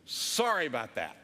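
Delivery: low imitation of a chastened dad; sheepish dad's apology. Dad apologizing to the pastor, low sheepish imitation. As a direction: drop the pitch into an embarrassed apology. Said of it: "Dad literally looks at me and he says".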